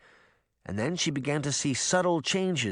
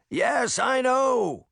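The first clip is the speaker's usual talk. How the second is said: higher, slow yell; 11 semitones higher, 4 dB louder, much slower than usual. A narrator's reading. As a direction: yell back over the storm. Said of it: "Tom yelled".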